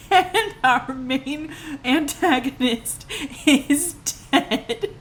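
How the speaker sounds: high, slow, dramatic delivery; incredulous announcement. Real, not acted. Storyteller announcing the villain's death mid-movie, incredulous.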